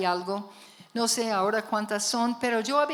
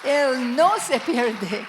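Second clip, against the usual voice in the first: fervent preaching; 2 semitones higher, slower than usual. Acted. Pastor proclaiming to her brothers, building fervent preaching. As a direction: lift the church with the good news.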